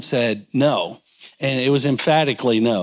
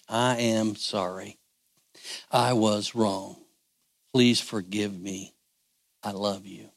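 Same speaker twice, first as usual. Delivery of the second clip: softer and slower, a sincere plea to your spouse; soft tender apology; 5 semitones lower, 8 dB quieter than usual. Shown as a demonstration. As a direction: say it gently, as if truly asking forgiveness. Models apology to spouse, softly.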